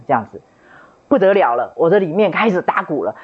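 ordinary delivery

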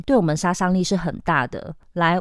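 ordinary talk

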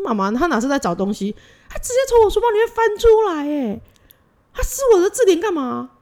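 high, loud indignant child, acted out; imitated angry outburst. Daughter complains to mom about brother's mess, imitated angry outburst. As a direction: play a furious big sister's tirade. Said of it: "他超生气".

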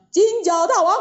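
high loud shout of a name; loud call. Acted. Monkey king calling the demon by name, loud shout. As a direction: call his name out across the distance. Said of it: "他大叫一声".

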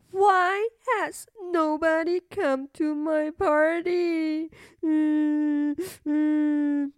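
put-on slow tearful little girl; sobbing princess. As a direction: cry it out through sobs. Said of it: "she sobbed".

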